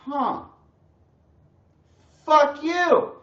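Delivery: high, loud, indignant retort; hammed-up huffy comeback; mock offended snap. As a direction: play his younger self taking offence. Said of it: "And I'd be like".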